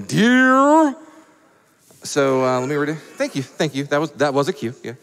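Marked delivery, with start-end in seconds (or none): 0.0-0.9 drawn-out rising mock yell; imitated yelling. Imitating people yelling in the morning, long high call. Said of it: "people yelling".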